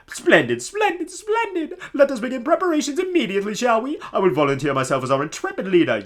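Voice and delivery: grand, high theatrical nobleman voice; pompous Flavio. Acted. Streamer voicing Flavio to the player, hammy pompous. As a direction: ham up the vain pirate-loving noble.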